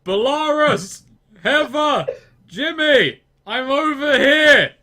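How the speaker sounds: high, loud calls name by name; yelling. Acted. Lost Marley calling out to the party, yelling. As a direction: holler for lost friends, stretching the last words. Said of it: "Cut to Marley just yelling".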